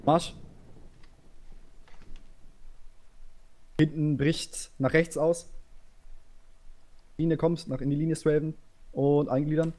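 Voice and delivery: calling orders to a line; barked drill commands. Real, not acted.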